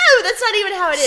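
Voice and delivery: high, loud laughing protest. Real, not acted. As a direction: laugh while objecting. Cohost reacting to outrageous joke.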